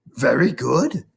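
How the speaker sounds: ordinary delivery, nothing marked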